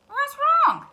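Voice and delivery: high loud duck call; concerned duck. Acted. Duck calls to his hurrying friend. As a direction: call out to the passing hen. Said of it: "asked Ducky Lucky".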